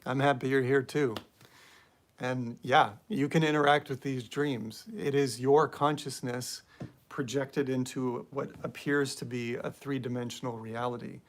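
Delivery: ordinary talk, nothing marked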